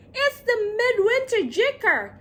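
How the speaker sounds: high, loud warning shout; yelling. Acted. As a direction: yell the alarm. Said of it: "and he yelled".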